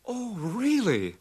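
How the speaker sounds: high, slow "really?"; surprised. Acted. Henry hears of the date.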